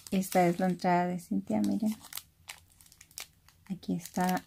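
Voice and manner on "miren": ordinary delivery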